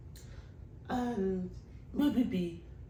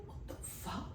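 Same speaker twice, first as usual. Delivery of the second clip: stunned whisper; 13 dB quieter than usual. Retold or imitated. Shocked by crying caller.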